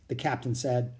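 usual speaking voice

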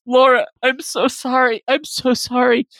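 fake-sob the apology; mock sobbing. [name] apologising to Laura through mock sobs. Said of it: "[name] speaks through sobs".

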